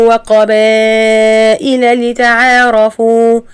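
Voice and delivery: slow, drawn-out chanted recitation; slow Quranic chant. Shown as a demonstration. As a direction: recite it slowly like tajweed.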